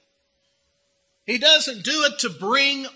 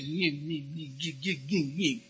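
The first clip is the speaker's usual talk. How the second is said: low, much softer nyeh-nyeh grumble; a low petulant nyeh nyeh; mocking sulky whine; 7 semitones lower, 10 dB quieter than usual. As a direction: grumble like a sulking child, under the breath. Sulking brother mocked.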